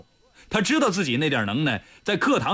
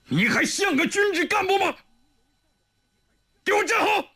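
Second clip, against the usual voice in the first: much higher, shouted command; furious roar. A narrator's reading. The dean roaring at Li Yunlong to stand straight, furious. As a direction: bellow it at him in fury. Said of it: "怒不可遏地吼道".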